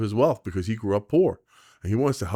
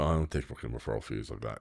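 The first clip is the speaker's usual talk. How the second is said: quiet insistence, no fee; low firm refusal; 10 semitones lower, 11 dB quieter than usual. Retold or imitated. Agent refusing the kid's referral fee, low and firm. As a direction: lower and softer, firmly turning it down.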